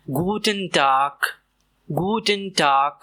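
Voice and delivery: गुडन टाग slowly, t and final k crisp; slow गुडन टाग to repeat; slow drill Guten Tag. Shown as a demonstration. Teacher drilling गुडन टाग slowly. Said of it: "Repeat after me".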